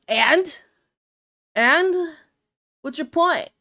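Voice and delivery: unbothered comeback to a fat joke; defiant retort. Retold or imitated. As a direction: throw it back at him. Retorting to insult.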